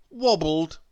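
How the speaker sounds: plain reading voice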